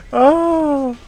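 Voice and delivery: ordinary delivery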